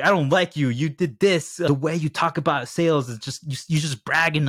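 high-pitched pretend telling-off; mock attack. Host pretends to go at guest Michael, mock attack. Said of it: "if I just started going at you".

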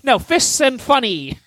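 correcting the name in a hammed-up promo voice; mock announcer title. Shown as a demonstration. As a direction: announce Fists and Funny like a movie trailer. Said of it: "gimmick for this episode".

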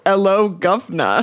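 slow British "'ello, guv'nor"; drawn-out mock-British greeting; British accent. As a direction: quote it in a hammed-up British accent. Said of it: "read it with a British accent".

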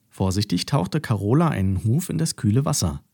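usual speaking voice

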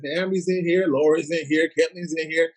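ordinary delivery

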